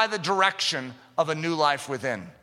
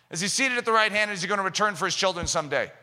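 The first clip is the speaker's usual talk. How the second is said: ordinary talk, nothing marked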